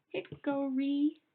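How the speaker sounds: spelled out slowly. Shown as a demonstration. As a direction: draw out Hickory syllable by syllable for the class. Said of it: "let's spell that out".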